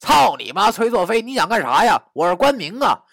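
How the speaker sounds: loud, high, indignant protest; agitated high-pitched cursing; struggling shout. Acted. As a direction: yell and curse while pinned down. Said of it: "他在我身下挣扎地喊道".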